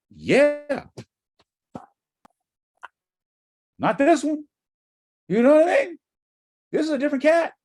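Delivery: high, loud insistent answer; fired-up actor insisting on fresh work; emphatic. Shown as a demonstration. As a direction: drive home that every role is new.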